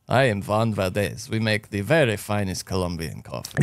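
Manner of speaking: lightly voiced Juan Valdez, Colombian accent. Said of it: "can you do it in a Colombian accent".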